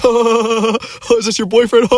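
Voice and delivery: very high, mocking bully jeer; Nelson-style taunt. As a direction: jeer like a cartoon bully pointing and laughing. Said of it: "like Nelson from The Simpsons".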